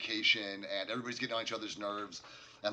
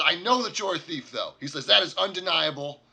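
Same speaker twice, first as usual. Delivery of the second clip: louder, higher firm charge; stern accusation; 4 semitones higher, 8 dB louder than usual. Acted. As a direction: point the finger at the thief.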